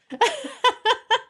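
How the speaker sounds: high-pitched burst of giggling; high laugh. Real, not acted. Streamer laughing at own kobold joke, high giggle. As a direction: laugh up high in a quick run.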